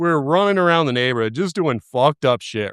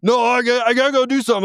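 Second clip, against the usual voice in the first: flustered excuse; 6 semitones higher, faster than usual. Retold or imitated. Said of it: "but I'm just like".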